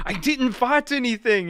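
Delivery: high, loud, bad French accent; thick French accent. French kid denying the blame, thick French accent. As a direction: imitate an indignant kid in a botched French accent. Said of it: "thick French accent".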